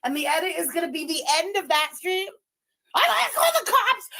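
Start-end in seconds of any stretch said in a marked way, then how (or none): 2.9-4.1 very high mocking squeal; mock threat. Streamer plans an edit of her rival's threat, mocking squeal.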